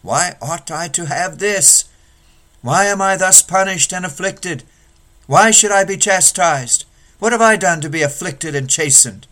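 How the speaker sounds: rising aggrieved complaint; murmuring complaint. Acted. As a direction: whine at the Father like a wronged child. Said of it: "murmur at him".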